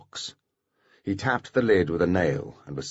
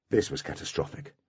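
ordinary delivery, nothing marked

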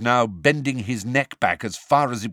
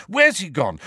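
louder, higher and pressing; urgent demand; 10 semitones higher, 4 dB louder, slower than usual. A narrator's reading. Harry demands the elf tell where Sirius went, urgent. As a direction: push him for an answer, slowing on the repeat.

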